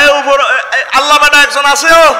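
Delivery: high, loud mock villager yelling upward; mocking shout. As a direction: caricature them shouting at the sky. Mocks people saying Allah beta. (উঁচু চড়া গলায় ব্যঙ্গাত্মক চিৎকার)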